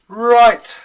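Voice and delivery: sharp, loud, high "Right"; demanding little man shouting; loud announcement. Acted. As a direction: burst in and claim the floor.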